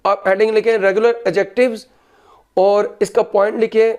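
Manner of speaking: ordinary talk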